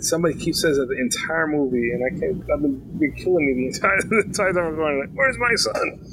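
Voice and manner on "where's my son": mock Liam Neeson yell. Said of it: "I'm picturing Liam Neeson yelling".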